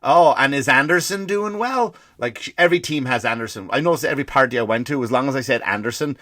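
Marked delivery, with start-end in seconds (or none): 0.0-1.9 higher, louder and slow, mock-sweet wife; wife eagerly trying to sound like she knows the game; lightly voiced wife, eager and sweet; clueless wife. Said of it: "wife asks again".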